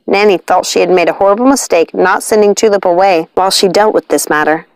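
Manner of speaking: usual speaking voice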